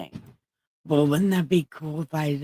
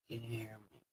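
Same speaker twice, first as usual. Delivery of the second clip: much softer, low "Can you hear me?"; low quiet test; 5 semitones lower, 23 dB quieter, much faster than usual. Shown as a demonstration. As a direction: say it almost under the breath. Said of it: "I'll talk real low".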